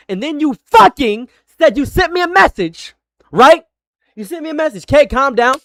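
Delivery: high, very loud outburst; angry accusation. Real, not acted. Streamer accuses caller over the supposed message, shouting angrily. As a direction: explode high and loud at them.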